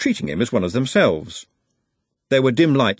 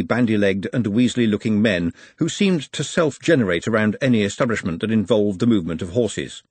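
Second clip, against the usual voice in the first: ordinary delivery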